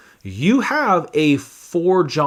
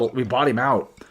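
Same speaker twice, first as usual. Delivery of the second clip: normal talking voice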